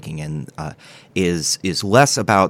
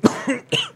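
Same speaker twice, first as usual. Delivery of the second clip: dry cough. Acted. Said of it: "Do you need a minute".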